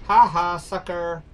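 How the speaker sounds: loud gloating ha ha; triumphant mocking laugh-call; loud taunt. Real, not acted. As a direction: jeer at the beaten enemy. Taunting enemy in game.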